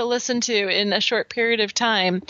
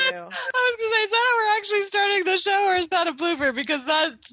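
laughing; 13 semitones higher than usual. Real, not acted. Laughing reaction to blooper.

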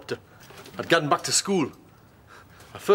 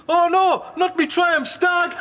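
higher, slow, hammed-up wail; mock panic; 10 semitones higher, much slower than usual. His old mate seeing his car destroyed, mock panicked wail. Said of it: "He comes out".

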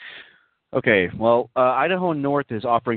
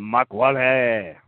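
hammed-up French-accented pope; slow, drawn-out mock French with "oh, ho, ho"; slower than usual. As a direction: put on an exaggerated French accent. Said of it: "does he speak with a French accent".

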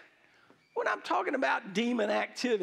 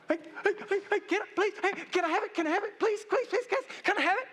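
high, rapid-fire pestering; begging five-year-old; 8 semitones higher, much faster than usual. Acted. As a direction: plead over and over like a pestering small kid. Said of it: "five-year-old kid before".

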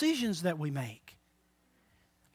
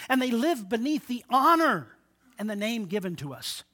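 ordinary talk

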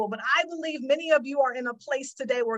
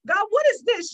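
higher and louder, exasperated complaint; mock frustrated outburst; 9 semitones higher, 6 dB louder than usual. Preacher acting out her complaint to God, high exasperated outburst. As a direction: throw the question up at God, fed up. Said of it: "And I'm like".

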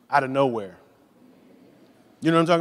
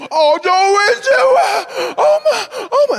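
very high, loud gush; wife overwhelmed by a baby photo, squealing high; wife gushing over photo; 8 dB louder, slower than usual. Retold or imitated. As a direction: swoon over an old baby picture. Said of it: "And she'll be like".

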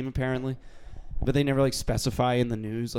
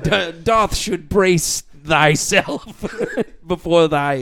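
slow, higher fantasy-knight declamation; mock archaic knight; 4 semitones higher, 7 dB louder, much slower than usual. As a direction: declaim it like a knight, breaking into laughs. Mock archaic fantasy player lines.

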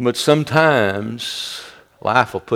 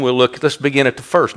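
usual speaking voice

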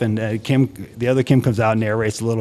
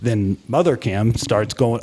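normal talking voice

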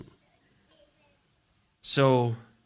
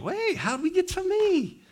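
mock surprise. Pastor voicing Timothy's surprise.

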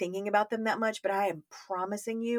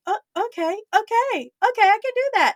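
much higher, louder, timid okays; squeaky meek agreement; 7 dB louder than usual. Retold or imitated. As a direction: agree in a tiny high voice. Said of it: "I was like".

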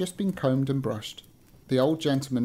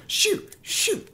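high-pitched storybook shooing; shooing away. A narrator's reading. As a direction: chase the cat off in a high voice. Old gentleman shooing a cat in story.

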